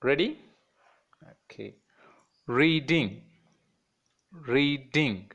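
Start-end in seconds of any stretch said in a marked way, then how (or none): 2.5-5.3 "reading" as ree, gap, ding, each time; two separate beats in "reading"; syllable by syllable. Shown as a demonstration. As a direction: leave room for a clap inside "reading". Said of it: "clap in between the syllables".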